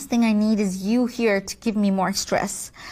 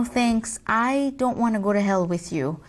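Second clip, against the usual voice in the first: ordinary talk, nothing marked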